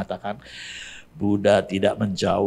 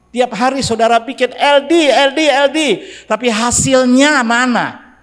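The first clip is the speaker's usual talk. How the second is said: higher, louder exhortation; fiery preaching; 11 semitones higher, 7 dB louder than usual. Acted. Preacher rebuking the congregation for not evangelising, higher and louder. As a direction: drive the point home to the church.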